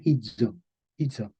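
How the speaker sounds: hizo as HI-dzo, said softly; medieval pronunciation. Shown as a demonstration. Teacher repeating for students, medieval pronunciation. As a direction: repeat hizo quietly with a buzzed z. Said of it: "Se pronuncia hizo".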